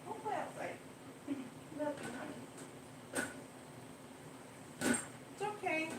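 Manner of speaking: high, soft, soothing pet voice; comforting the cat. Real, not acted. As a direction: coo gently to calm a scared kitty.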